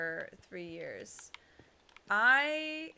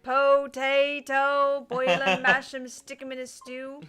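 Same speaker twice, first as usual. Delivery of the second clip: movie quote; 3 semitones higher, 8 dB louder, slower than usual. Retold or imitated. Quoting Lord of the Rings.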